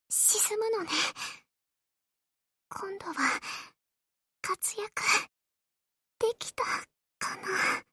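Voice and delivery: fading to almost nothing, breathy at the end; dying murmur. Acted. Sinking carrier's last words to the admiral, barely audible. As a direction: let the words slip away as she sinks.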